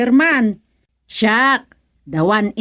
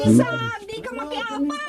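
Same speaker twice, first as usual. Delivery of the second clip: put-on high, softer old woman's rebuke; old woman scolding; 12 semitones higher, 4 dB quieter than usual.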